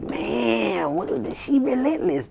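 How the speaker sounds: high disbelieving whine; astonished haters. Retold or imitated. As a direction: sound amazed someone won't quit. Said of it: "And they was like".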